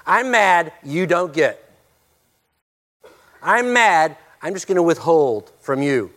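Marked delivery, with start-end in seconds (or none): none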